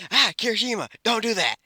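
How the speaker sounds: high startled yelp of protest; startled jump. Retold or imitated. Bakugo jumping as Kirishima sneaks up behind, startled. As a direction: jolt up in pitch, caught off guard. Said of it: "Bakugo jumps and says".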